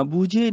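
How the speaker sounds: ਬੂਝੇ as "boo-jhay"; long oo, final ay in ਬੂਝੇ; wrong long vowel. Shown as a demonstration. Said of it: "ਬੂਝੇ ਨਹੀਂ". (ਬੂਝੇ, ਲੰਮੇ "ਊ" ਨਾਲ)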